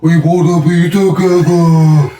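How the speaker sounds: loud, high, drawn-out mimicry; slow, stretched comic cry; advert catchphrase impression. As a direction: belt it like a silly advert line. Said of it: "he goes".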